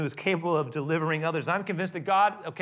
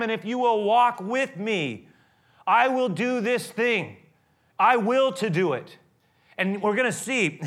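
high and loud, pounding sermon voice, much higher and louder; preaching at full force; 8 semitones higher, 9 dB louder than usual. Acted. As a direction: drive it home to the congregation. Preaches Moses and God story.

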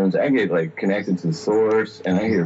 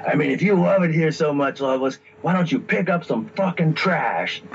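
higher-pitched imitated devil nagging; mock devil reproach, pitch raised; big devil voice; 7 semitones higher than usual. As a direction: lightly play a scolding devil. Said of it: "then this voice says".

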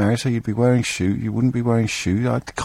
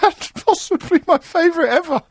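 real helpless giggles, voice pitched way up; laughing. Real, not acted. Host tickled by the caller's punchline, laughing. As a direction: talk through high, squeaky laughter. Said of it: "You and me both".

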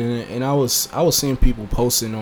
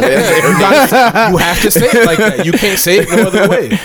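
words breaking up with laughter; laughing; 6 semitones higher, 6 dB louder than usual. Real, not acted. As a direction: insist while laughing hard. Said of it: "laughing while talking".

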